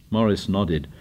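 usual speaking voice

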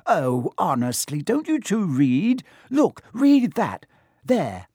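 high, slow exasperation; exasperated. A narrator's reading. Girl scolds two friends who never read, exasperatedly.

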